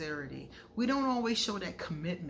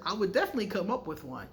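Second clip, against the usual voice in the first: ordinary talk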